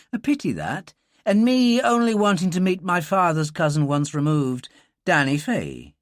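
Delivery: lightly Irish, easy and teasing; a hint of a charming Irish rogue. Dylan charming the wary girl, light Irish lilt.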